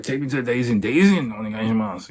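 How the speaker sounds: high, pushy plea to the driver; urgent drunk demand. Retold or imitated. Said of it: "I'm like".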